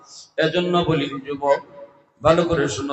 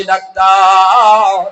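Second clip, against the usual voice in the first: loud, long-drawn cry; high, stretched appeal; calling out; 5 semitones higher, 13 dB louder, much slower than usual. Acted. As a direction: wail the word out at length. Acted cry to the doctor.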